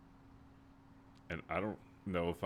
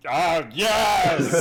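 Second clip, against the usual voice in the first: loud laughing "ah yes"; excited shout; 8 semitones higher, 13 dB louder than usual. Acted. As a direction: burst out with a joyful laugh. King greets druid with a hug.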